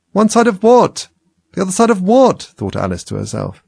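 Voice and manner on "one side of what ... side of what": high, loud baffled question; incredulous, pitched well up on each question; puzzled exclamation. A narrator's reading. Alice puzzled by cryptic hint.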